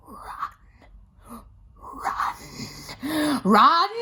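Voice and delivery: from breathy whisper to loud yell; building menacing command. Retold or imitated. Nan ordering the child to flee, whisper building to yell. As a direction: start hissed under the breath, explode on the last one.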